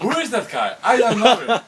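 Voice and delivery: high, loud, laughing cartoon voice; Stewie voice. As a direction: shriek it through laughter. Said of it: "then he said".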